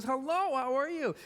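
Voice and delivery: imitating an overjoyed old friend; eager greeting. Football captain hugging him like a best friend, high and eager. Bully greets with bear hug.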